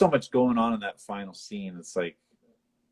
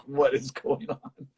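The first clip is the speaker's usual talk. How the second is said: confused; 4 semitones higher than usual. Retold or imitated. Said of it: "he's just like".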